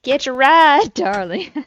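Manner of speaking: loud, sassy Southern "darling". Acted. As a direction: toss it off with big sass. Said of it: "sassy Southern lady".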